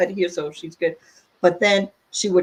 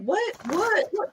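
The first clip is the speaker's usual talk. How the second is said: confused coworkers; 11 semitones higher, slower than usual. Retold or imitated. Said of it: "They're like".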